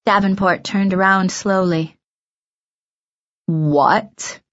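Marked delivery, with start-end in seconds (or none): 3.5-4.4 low, incredulous question; stunned disbelief. A narrator's reading. Director to a girl backing out, stunned. Davenport stunned at Miranda quitting.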